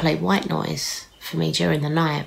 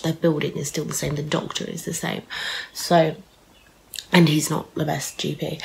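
ordinary talk, nothing marked

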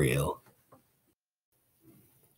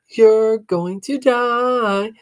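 slow, drawn-out teasing chant; sing-song taunt; 13 semitones higher, 4 dB louder, much slower than usual. Acted. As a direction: taunt it like a playground rhyme. Sister taunts brother.

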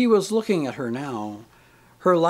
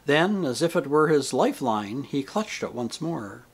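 ordinary delivery

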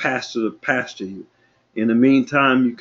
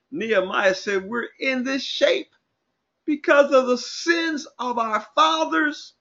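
raised-pitch preacher declaiming scripture; scripture preaching; 9 semitones higher than usual. Real, not acted. Preacher citing Nehemiah and Romans, scripture preaching. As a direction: declaim the verse with rising intensity.

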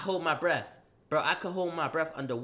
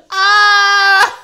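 sudden high yelp, much louder; strangled cry; 14 semitones higher, 18 dB louder than usual. Retold or imitated. Grabbed in a chokehold, a shrieked ah.

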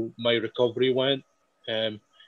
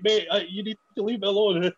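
higher, mock-exasperated plea; amused, higher get-off-me; exasperated brush-off; 8 semitones higher than usual. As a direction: push him away with amused annoyance. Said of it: "I'm like".

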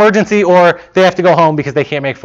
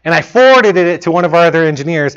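normal talking voice

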